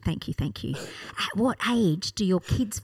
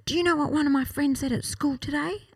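lightly voiced child's question, pitched up; a hint of little boy, higher; Jaden; 7 semitones higher than usual. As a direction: imitate a son with news from school. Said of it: "walking home from school with Jaden".

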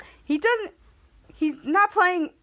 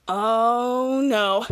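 low mock dread; 4 semitones lower than usual. Voicing Miles' dread.

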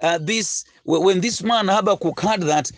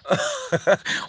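high burst of laughter; 3 dB quieter than usual. Real, not acted. Laughs at own impression.

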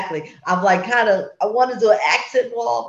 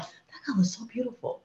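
whispered, much softer, to herself; awed whisper; 4 semitones higher, 12 dB quieter than usual. Retold or imitated. Co-host whispering how she admires her new gray paint. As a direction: breathe it out in quiet awe.

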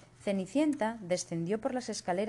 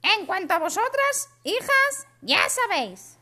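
very high, loud shrill stepmother; wicked stepmother; 9 dB louder than usual. Acted. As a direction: bark the order like a nasty stepmother. Stepmother addresses her daughters.

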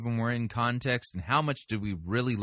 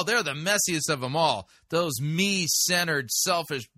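sarcastic mockery; 6 semitones higher than usual. Real, not acted.

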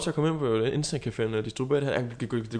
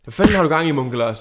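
loud, raised-pitch telling-off; mock angry scolding; 3 semitones higher, 7 dB louder than usual. [name] scolds Munke Lars for shoving him, in a mock angry shout. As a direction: lay into him with acted exasperation.

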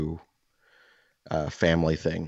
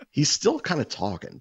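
ordinary talk, nothing marked

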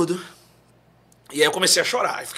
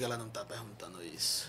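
low, soft stunned murmur; much lower, much softer aside; hushed aside; 10 semitones lower, 15 dB quieter than usual. Retold or imitated. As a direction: mutter it in disbelief. Disbelieving aside to himself.

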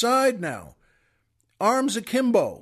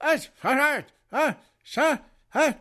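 high-pitched angry babble, imitating a foreign tongue; mock angry gibberish; 6 semitones higher than usual. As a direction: imitate someone scolding in nonsense sounds. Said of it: "loud and angry".

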